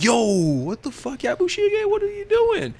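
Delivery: very high-pitched disbelieving outcry; mock shock. Viewer reacting to Yabushige's sudden killing, high mock shock. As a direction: stretch the yo, then squeal in mock disbelief.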